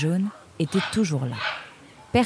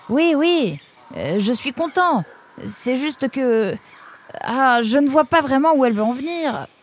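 higher, hesitant worried voice; anxious friend; 8 semitones higher than usual. Acted. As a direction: protest uneasily in a raised pitch. Nemrod inquiet face à son ami. (voix inquiète, hésitante et aiguë)

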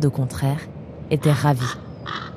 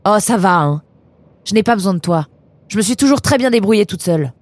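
raised, higher voice, annoyed; irritated retort; 4 semitones higher, 5 dB louder than usual. Acted. Eunice tells Nemrod she needs nobody, irritated retort. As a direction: push back, proud and cross. Eunice snaps back in quarrel.

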